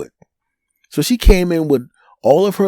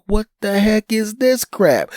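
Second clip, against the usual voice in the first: higher, slow, incredulous mock girlfriend; imitating girlfriend; 8 semitones higher, slower than usual. Girlfriend, baffled at her sister, in slow high disbelief. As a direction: drag it out like she can't believe it. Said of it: "my girlfriend at the time's like".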